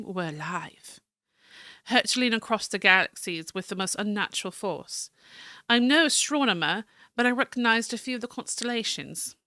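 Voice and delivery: American attempting a refined British accent; fancy British accent. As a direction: read the journal in fancy British English. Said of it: "Fancy British".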